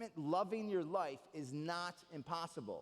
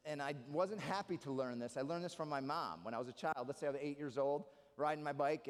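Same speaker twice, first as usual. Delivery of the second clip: ordinary delivery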